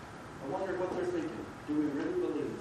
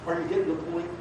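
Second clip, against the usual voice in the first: usual speaking voice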